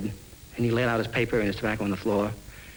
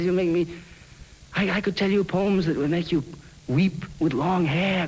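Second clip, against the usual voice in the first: higher, emphatic boast; boasting poet; 9 semitones higher than usual. Acted. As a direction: puff up and threaten with poems. Poet boasting before leaving.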